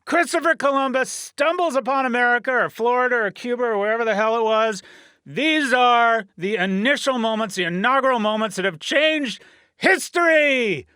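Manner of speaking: trailer-voice grandeur over history's big moments; epic announcer. Acted. Host opening his podcast with mock-epic announcer voice.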